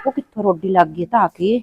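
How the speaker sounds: muttered aside. Acted. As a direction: mutter it so the aunt can't hear. (ਭੂਆ ਬਾਰੇ ਹੌਲੀ ਜਿਹੀ ਬੁੜਬੁੜ)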